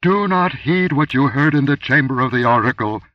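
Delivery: high, loud commanding goddess voice; terrible rolling voice. A narrator's reading. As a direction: roll the warning down like thunder. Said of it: "rolled that terrible voice".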